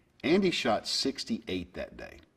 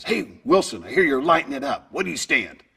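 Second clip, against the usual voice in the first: higher, louder upbeat coach voice; lightly voiced eager coach; excited coach; 5 semitones higher, 6 dB louder than usual. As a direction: call out to the player like a pumped-up coach. Coach greeting at the turn.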